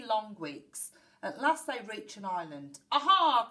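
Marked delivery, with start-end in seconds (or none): 2.9-3.5 loud, high "aha" from a pirate captain; exclaimed cry. A narrator's reading. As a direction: cry out in sudden triumph. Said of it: "cried Pap".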